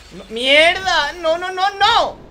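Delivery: loud, drawn-out cry of no; frustrated shout. Real, not acted. Gamer yelling at the bus game as it fails. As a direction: yell at the screen as it fails. Bus reversing goes wrong.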